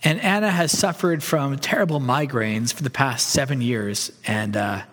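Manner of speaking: normal talking voice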